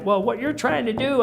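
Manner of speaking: retold rant, pitched high without full volume; mock irate yelling. Customer re-enacting his phone row with the car shop, mock irate. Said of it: "and I'm yelling".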